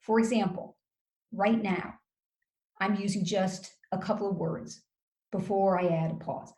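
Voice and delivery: "right now" set apart by short pauses; short phrases, each followed by a brief pause; micro pauses. Shown as a demonstration. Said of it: "short sentences with little micro pauses".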